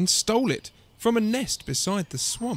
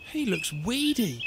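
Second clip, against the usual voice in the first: disapproving mother, lightly voiced; grumbling; 4 semitones higher than usual. A narrator's reading. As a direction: grumble with mild disdain. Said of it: "she grumbled".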